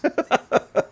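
high-pitched laugh at own joke; amused laugh. Real, not acted. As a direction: laugh after your own skit. Laughing after fox skit.

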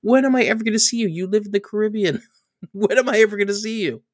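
mock-eager justification to the boss; eager explanation. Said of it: "He's like".

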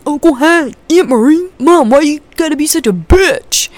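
high, loud TV-character impression; Walt Junior. Imitating a video of a TV son challenge, high and loud. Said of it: "the best Walt Junior from Breaking Bad accent".

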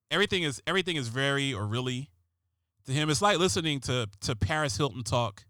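usual speaking voice

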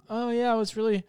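lightly put-on drunk voice; drunk woman. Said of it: "she was, like, drunk".

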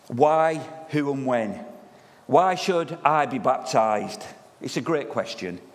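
ordinary talk, nothing marked

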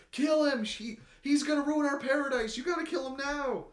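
high-pitched, loud screamed command; frantic acted yelling. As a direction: shriek it like a panicked crowd urging someone on. Family urgently yelling at the girl.

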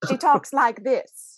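hammed-up mid-Atlantic accent; mock mid-Atlantic accent. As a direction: play up a mid-Atlantic lilt. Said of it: "Say that again".

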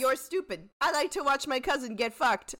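hammed-up drunken taunts; imitated drunk rant. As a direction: join in quoting the drunk insults. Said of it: "The guy who's drunk on the beach".

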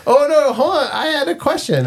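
jumps up high in pitch to cut in; high, abrupt bid for the floor; sudden high interruption. Real, not acted. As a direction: squeak up to stop the wrap-up. Interrupting to ask.

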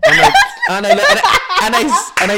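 shrieking high laughter; laughing. Real, not acted. Laughing fit.

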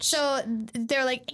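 ordinary delivery

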